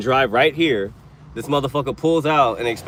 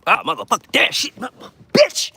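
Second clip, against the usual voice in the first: venting curse burst; 12 semitones higher than usual. Real, not acted. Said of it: "get all these damn curse words out".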